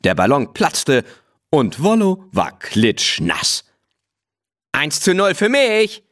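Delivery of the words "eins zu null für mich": crowed much higher, triumphant and cheeky; crowing. A narrator's reading. Willi taunting the soaked horse with a much higher triumphant crow. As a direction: crow it like a boy who just won. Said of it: "krähte Willi".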